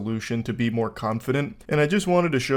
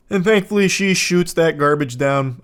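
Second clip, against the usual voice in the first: normal talking voice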